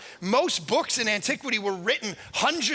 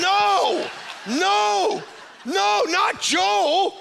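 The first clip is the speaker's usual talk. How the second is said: high, loud, stretched-out cry of no; mock despairing no; 8 semitones higher, 4 dB louder, much slower than usual. Believer begging not to send Joe, mock despairing wail. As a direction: wail it like the worst news ever. Audience dreading Joe as champion.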